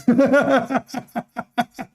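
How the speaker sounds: long scornful laugh; mocking laugh. Real, not acted. Laughing at the caller.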